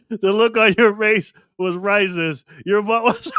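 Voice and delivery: high voice breaking into laughter; laughing while talking. Real, not acted. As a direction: try to get it out before cracking up. Trying to talk through laughter.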